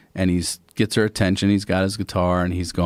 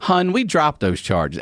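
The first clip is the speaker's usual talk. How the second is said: higher, slow, lightly voiced aunt; a hint of a Baltimore great-aunt, drawn out; imitated Baltimore aunt; 6 semitones higher, slower than usual. Great-aunt addressing the judge, higher lightly mimicked Baltimore voice. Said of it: "Everyone calls people hun in Baltimore".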